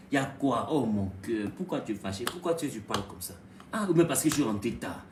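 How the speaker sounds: low, soft coaxing; husband softly asking his upset wife what's wrong; gentle husband. Acted. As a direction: soothe her quietly and tenderly.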